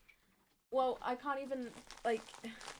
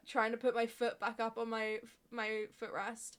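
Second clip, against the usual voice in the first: ordinary delivery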